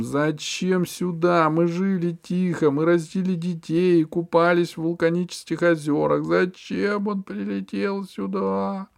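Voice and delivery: loud, tearful howl; whiny wail. A narrator's reading. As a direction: wail it like a crybaby. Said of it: "плаксиво взвыл".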